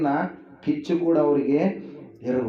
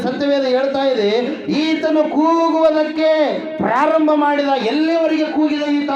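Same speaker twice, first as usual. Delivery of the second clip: high, insistent preacher's voice; raised, ringing sermon delivery; impassioned preaching; 8 semitones higher, 4 dB louder than usual. Acted. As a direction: drive it home with rising fervour. Preaches at full force.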